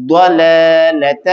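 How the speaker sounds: ضلالت slow, daad heavy and full. Shown as a demonstration. Teacher models ضلالت slowly for the class. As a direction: drag out ضلالت with a thick daad. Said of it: "دعات موٹا بھی ادا کریں گے".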